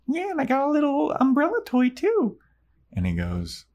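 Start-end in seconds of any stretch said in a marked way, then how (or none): 0.1-2.4 much higher, light mock girl voice; high girly Buffy. Recapping Buffy at prom, high mock girl voice. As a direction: squeak it out like a teen girl. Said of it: "And Buffy goes".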